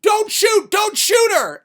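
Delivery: high, loud screamed plea; acted-out frantic shout; urgent scream. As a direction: scream to stop someone from firing. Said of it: "She screams to him".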